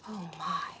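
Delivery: awed little whisper; a hushed gasp of wonder. Acted. Awed aside to children.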